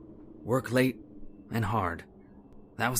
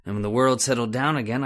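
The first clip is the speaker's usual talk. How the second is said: ordinary delivery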